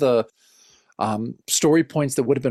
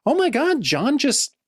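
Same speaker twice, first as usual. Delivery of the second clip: high, slow gasped disbelief; shocked retelling; mock shock; 11 semitones higher than usual. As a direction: act out being stunned. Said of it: "because I'm like".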